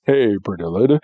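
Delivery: low-pitched cartoon ladies' man; Johnny Bravo. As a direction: drop the voice into a mock flirt. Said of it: "He's always been Johnny Bravo".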